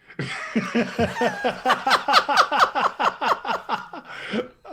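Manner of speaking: loud, high, long belly laugh; big laugh. Real, not acted. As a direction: burst out in prolonged laughter. Cracking up over the vape.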